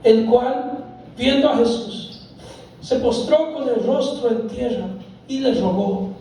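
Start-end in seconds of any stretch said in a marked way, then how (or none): none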